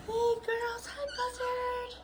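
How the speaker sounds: pet talk. Real, not acted. Greeting pet birds.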